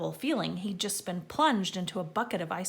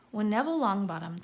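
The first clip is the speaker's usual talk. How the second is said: usual speaking voice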